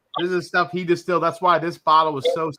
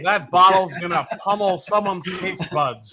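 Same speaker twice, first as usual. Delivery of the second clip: home shopping host; 4 dB louder than usual. Retold or imitated. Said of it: "like, home shopping network delivery".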